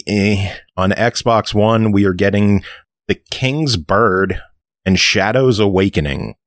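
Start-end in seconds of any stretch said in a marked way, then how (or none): none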